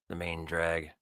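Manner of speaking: ordinary delivery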